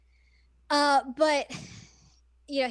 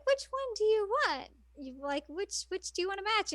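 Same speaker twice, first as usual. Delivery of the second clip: lightly voiced higher, offering ribbons; playful higher quoted question; high mock offer; 8 semitones higher than usual. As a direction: ask brightly like offering a choice.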